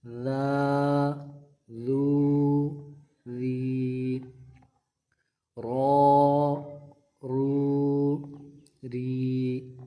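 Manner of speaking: را رو ری said gently; no whistle, soft tongue; soft letter chant. Shown as a demonstration. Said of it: "یہ رال نرمی کے ساتھ پڑھنا ہے".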